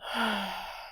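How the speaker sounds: soft, long sigh; audible exhale. Shown as a demonstration. Descending with each out-breath, soft audible exhale. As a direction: release the breath slowly and softly.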